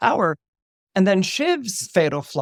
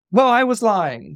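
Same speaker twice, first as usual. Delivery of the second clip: higher, louder, desperate blurt; 6 semitones higher, 5 dB louder than usual. Retold or imitated. Kendall desperate to win Shiv's vote, blurting. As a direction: grasp at any excuse. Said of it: "in desperation".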